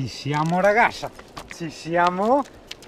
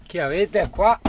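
usual speaking voice

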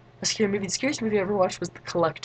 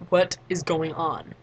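slow, evenly spaced question at usual pitch; deliberate, measured mock confusion; slow bewilderment; slower than usual. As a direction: draw out each word as a retold baffled question. Own bewildered question after screaming.

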